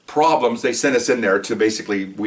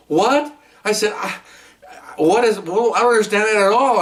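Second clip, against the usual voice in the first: much higher, baffled what; mock surprise; 11 semitones higher than usual. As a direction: play his own shocked reaction, pitched way up. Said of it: "I go".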